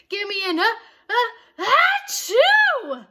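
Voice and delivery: building to a loud high sneeze; cheer into a sneeze. A narrator's reading. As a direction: cheer that breaks into achoo. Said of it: "sneezed the cheerleaders".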